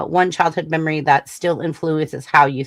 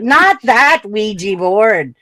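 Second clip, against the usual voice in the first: higher, louder and drawn out, mock exasperation; exasperated clarification; 5 semitones higher, 6 dB louder, much slower than usual. Retelling to coworkers who looked at her weird, mock exasperated. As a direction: protest it slowly, stressing the misunderstanding. Correcting people's odd looks in retelling.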